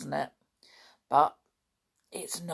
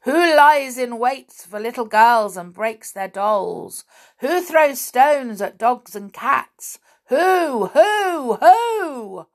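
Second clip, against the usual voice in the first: loud, high, insistent accusation; accusing disembodied voice; 7 semitones higher, 9 dB louder, slower than usual. A narrator's reading. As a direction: build to a hooting, drawn-out demand. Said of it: "began the voice again".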